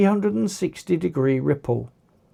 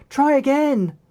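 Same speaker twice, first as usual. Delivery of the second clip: higher, raised encouraging call; 8 semitones higher than usual. A narrator's reading. Rhea urging Dylan to shoot again. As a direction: call it out across the room. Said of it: "Rhea called out".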